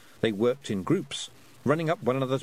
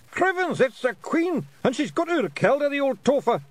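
much higher, loud Scottish pixie; Feegle shout; 5 dB louder than usual. A narrator's reading. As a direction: squeal it like a tiny Scots warrior. Nac Mac Feegle shouting.

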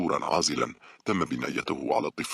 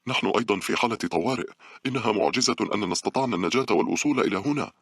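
higher, insistent appeal; pressing, raised-pitch protest to a guard; urgent pleading; 7 semitones higher, 3 dB louder than usual. A narrator's reading. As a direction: argue desperately to be let in.

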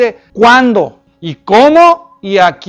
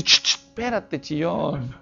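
soft shushing; 6 semitones lower, 10 dB quieter, slower than usual. Retold or imitated. Jesus hushing the whining man.

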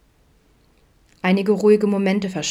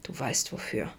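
grumbled, almost annoyed; 4 semitones lower, 10 dB quieter than usual. A narrator's reading. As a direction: lower and much softer, a grumble. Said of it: "brummte Adam fast genervt". (leise gebrummt, fast genervt)

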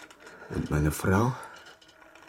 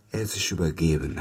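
normal talking voice

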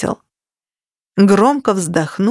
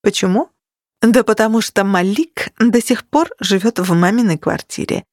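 ordinary delivery

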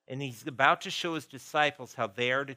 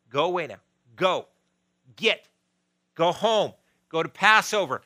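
short commands, building louder and higher; firm dismissal; 3 semitones higher, 7 dB louder than usual. Acted. Jesus telling the crowd to go home, firm dismissal. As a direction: send them packing, word by word.

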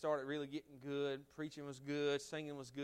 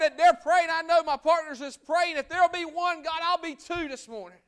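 loud, high, pleading prayer; fervent prayer; 8 semitones higher, 12 dB louder than usual. Acted. Pastor praying aloud for the church and school, fervent loud prayer. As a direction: cry out to God for help. Leads an altar-call prayer.

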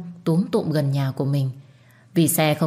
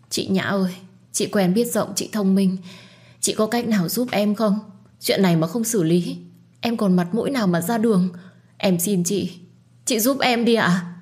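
higher, desperate begging; panicked pleading; 4 semitones higher than usual. Acted. Hương begging Nhã for help, panicked pleading. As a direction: plead with her older friend in panic. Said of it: "vẻ mặt vô cùng hoảng loạn và đau khổ".

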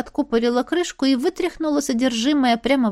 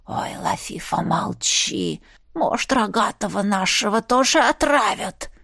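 hopeful snake whisper. Acted. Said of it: "С затаённой надеждой".

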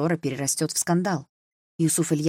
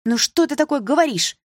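high, loud protest; daughters' voiced outcry, pitched up; indignant exclamation; 11 semitones higher, 6 dB louder than usual. A narrator's reading. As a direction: exclaim in disbelief at what was said. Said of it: "воскликнули сёстры в один голос".

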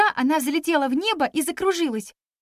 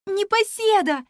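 shouting a name; 8 semitones higher than usual. A narrator's reading. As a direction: call out to a friend far off. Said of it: "закричала я".

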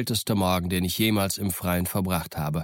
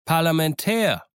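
calling out; 8 semitones higher, 3 dB louder than usual. A narrator's reading. Silver hails the stockade, calling out high. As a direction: hail them from a distance. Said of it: "rief Silver".

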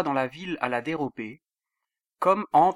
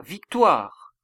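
louder, higher cry of victory; raised-voice triumphant announcement; 4 semitones higher, 7 dB louder than usual. A narrator's reading. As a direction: proclaim it to the king. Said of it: "Akhimaas cria et dit au roi".

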